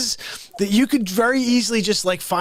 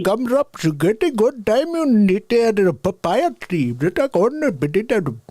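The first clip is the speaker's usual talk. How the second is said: silly character voice; 3 semitones higher than usual. Pretend. Nonsense character voice continues.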